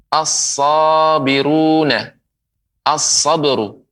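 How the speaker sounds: "as-sabiruna", "As-sabru" slowly with heavy doubled s; slow model, holding the s in "As-sabru"; lam merged into sad. Shown as a demonstration.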